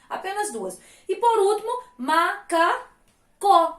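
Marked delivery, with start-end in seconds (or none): 2.0-3.8 "macacó" very slow, in three separate beats; syllable by syllable. Shown as a demonstration. Teacher showing the last syllable, "macacó" slowly split up. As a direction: say "macacó" as ma, ca, có with pauses. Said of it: "Você completa com um Czinho e um O".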